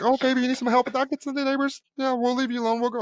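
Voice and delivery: very high, doting mother voice; imitating Southern mom. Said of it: "she was Southern mom".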